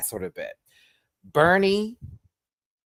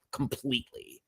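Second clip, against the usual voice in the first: whispered; 9 dB quieter than usual. Real, not acted.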